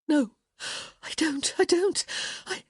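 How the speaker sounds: rising, high, broken protest; breathless denial. A narrator's reading. Woman protesting to her lover, breathless. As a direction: stammer it out, rising.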